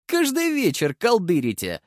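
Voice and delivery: put-on high girlish taunt; lightly voiced mocking girl; scoffing young woman. A narrator's reading. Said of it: "усмехнулась молодая девица".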